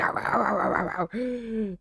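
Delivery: soft, slow yelping barks; mock barking. Barking at the bird to scare it off, mock yelps. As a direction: bark the bird away, playfully. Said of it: "barking to get this, like, bird away".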